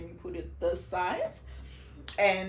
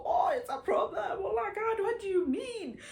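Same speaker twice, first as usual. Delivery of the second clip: mock shocked people; 12 semitones higher than usual. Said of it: "if you're saying".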